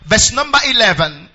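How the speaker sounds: reading the verses out loud in an unhurried preaching cadence; proclaiming scripture over the music. Acted. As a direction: lead the church through the verses, full voice.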